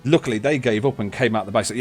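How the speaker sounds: ordinary delivery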